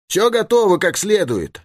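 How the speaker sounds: high, called-back reply; answering call. A narrator's reading. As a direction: call back to a comrade, pitched well up. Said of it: "откликнулся товарищ". (отклик товарищу высоким голосом)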